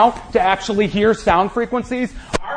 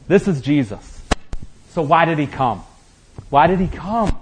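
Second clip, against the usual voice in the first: ordinary talk, nothing marked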